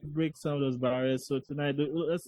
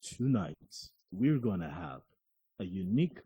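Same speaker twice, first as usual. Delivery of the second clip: announcer voice; 6 semitones lower than usual. Pretend. Host unveiling tonight's food to listeners, announcer voice. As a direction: draw it out like a dramatic announcer. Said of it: "let's get back that announcer voice".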